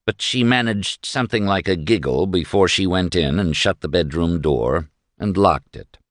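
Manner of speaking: plain reading voice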